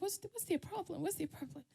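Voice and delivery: high, hushed bewilderment; puzzled aside. Retold or imitated. Child self wondering why classmates laugh, quietly puzzled.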